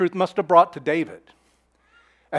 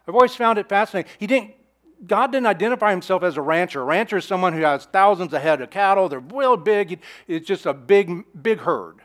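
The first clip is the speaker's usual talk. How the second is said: normal talking voice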